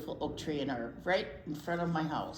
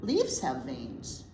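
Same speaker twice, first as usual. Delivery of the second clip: slow, gentle picture-book reading; reading to children; 3 semitones lower, slower than usual. Acted. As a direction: read it aloud for little listeners.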